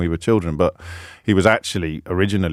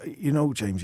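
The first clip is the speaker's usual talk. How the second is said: Bob's voice; 5 semitones higher, 7 dB quieter, slower than usual. Retold or imitated. Impression of taxi driver Bob.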